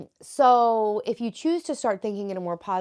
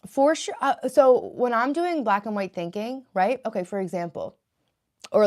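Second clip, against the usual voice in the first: ordinary talk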